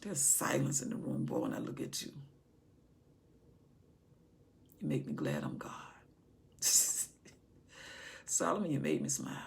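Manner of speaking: soft, hushed loving voice of God; God's gentle whisper to his son; tender whisper. Acted. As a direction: speak lovingly, nearly whispering. God delighted, speaking softly.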